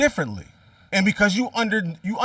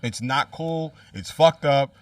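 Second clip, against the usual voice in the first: calm closing statement in an argument; low, serious; 9 semitones lower, 8 dB quieter than usual. Real, not acted. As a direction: drop the voice to make a final point. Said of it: "I'll leave it at this".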